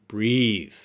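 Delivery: "breathe" with long ee and buzzed th; drawn-out "breathe", voiced final th; verb pronunciation. Shown as a demonstration. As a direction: say "breathe" to rhyme with seethe. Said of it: "Do not confuse the pronunciation".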